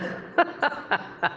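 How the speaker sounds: breathless giggle, way up high; unvoiced high cackle; high airy laugh. Real, not acted. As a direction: laugh silently at the memory. Laughing at doctor story.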